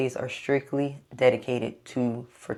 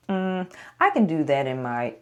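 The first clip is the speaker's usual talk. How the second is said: ordinary talk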